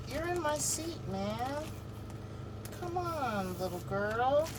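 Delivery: high, soft coaxing pet voice; talking to a pet. Real, not acted. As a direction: sweet-talk the dog off the chair. Shooing pet from seat.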